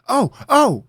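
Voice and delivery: high, loud eager cry; excited interruption. Acted. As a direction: jump in bursting with an idea. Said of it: "cut him off excitingly".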